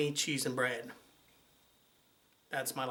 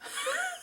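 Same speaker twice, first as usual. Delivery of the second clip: high giggle; 4 dB quieter than usual. Real, not acted. Laughing after retort.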